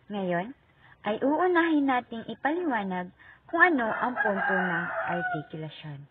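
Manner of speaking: normal talking voice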